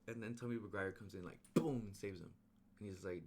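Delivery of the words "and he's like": ordinary talk, nothing marked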